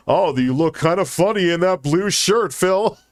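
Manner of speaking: high-pitched sarcastic mock compliment; hammed-up mocking quote; mocking tease. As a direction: feign teasing someone, voice lifted high. Mocking an imagined teasing remark.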